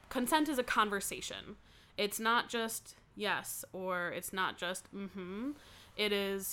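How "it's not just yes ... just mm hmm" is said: "not" stressed in "It's not just yes", said slowly; slow emphatic "not". Real, not acted. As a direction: lean on each "not", slowly.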